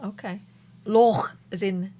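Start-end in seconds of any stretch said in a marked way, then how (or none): 0.9-1.4 loch with a Scottish throaty ending; Scottish accent. Acted. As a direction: end the word in a Scottish ch. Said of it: "can't say a Scottish word without trying to do the accent".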